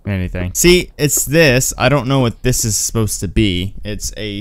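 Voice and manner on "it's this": usual speaking voice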